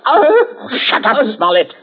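sharp, loud and high cry to silence him; a furious shout at the captive; angry outburst. Acted. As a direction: snap at him to shut up. Tense standoff at gunpoint.

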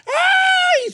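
very high, loud comic shriek of joy; hammed-up squeal of relief. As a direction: let out a squeal. Joyful arrival home.

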